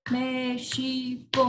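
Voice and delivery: MÉXICO slow, three even joined beats; counting out syllables. Shown as a demonstration. Teacher recalling first-grade counting, México in slow even syllables. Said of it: "a professora fazia contar".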